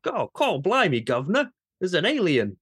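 put-on outrageous English accent, loud and high; ridiculous English accent. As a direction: overdo a silly English accent, Cockney guard. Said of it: "the most ridiculous English accent ever".

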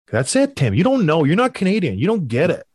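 ordinary talk

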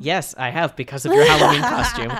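loud answer through laughter; laughing. Real, not acted. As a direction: crack up while answering loudly. Repeats answer, cracking up.

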